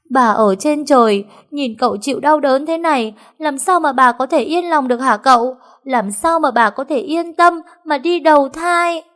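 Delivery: high, loud theatrical wailing; fake-weeping loud enough for the master; wailing lament. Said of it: "khóc lóc ỉ ôi". (khóc lóc ỉ ôi giả vờ)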